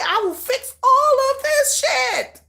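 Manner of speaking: high, slow, fanatical vow; hammed-up obsessive fan voice; mock crazed fan. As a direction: say it like a deluded self-appointed saviour. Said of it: "they're thinking that they could be".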